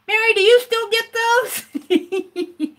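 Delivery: high, slow, teasing imitation of coworker. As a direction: tease her like the jokey colleague. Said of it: "would always identify me".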